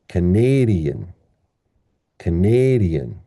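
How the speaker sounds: "Canadian" slow, as kuh-NAY-dee-un; stressed second syllable. Shown as a demonstration. Teacher repeating the model word, "Canadian" with long NAY. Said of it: "So this word becomes".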